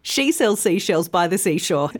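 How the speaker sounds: brisk tongue twister in one run. Shown as a demonstration. Teacher challenges learners with the twister, said quickly. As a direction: rattle it off without pauses. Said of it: "then more quickly".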